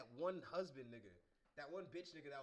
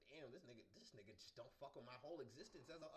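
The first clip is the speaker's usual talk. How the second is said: ordinary delivery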